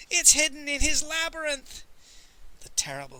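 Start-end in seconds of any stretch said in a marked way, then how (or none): 0.1-1.8 high, loud, panicked cry; screamed confession. A narrator's reading. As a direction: blurt it out in a terrified shriek. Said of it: "screamed Pawnbroker Fang".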